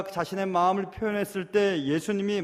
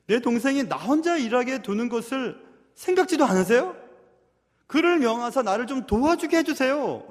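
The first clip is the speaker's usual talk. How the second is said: loud, higher-pitched aggrieved plea; pleading protest, pitched up; complaining sister; 6 semitones higher, 5 dB louder than usual. Acted. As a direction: act out an indignant older sister appealing. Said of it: "지금 언니가 얘기하는 것입니다".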